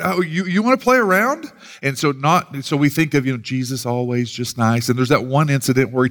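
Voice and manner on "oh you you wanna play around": high, mocking sarcastic taunt. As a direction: needle them sarcastically. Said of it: "his tone would probably be".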